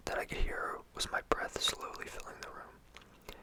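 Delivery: plain reading voice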